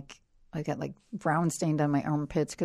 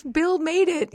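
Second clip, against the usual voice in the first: high-pitched, louder imitation of a laughing woman; laughing Felicity; 12 semitones higher, 6 dB louder, slower than usual. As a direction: imitate her amused reply, pitched way up. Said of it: "Felicity's laughing".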